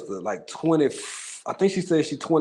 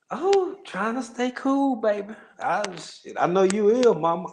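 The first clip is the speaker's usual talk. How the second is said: high playful tease; 8 semitones higher than usual. Real, not acted.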